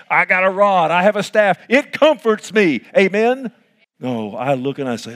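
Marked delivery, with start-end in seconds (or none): 0.1-3.5 loud, high, ringing preacher's call; preaching at full force. Acted. Pastor preaching loud to the congregation, full force.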